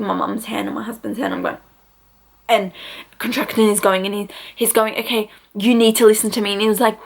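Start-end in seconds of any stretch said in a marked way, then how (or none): none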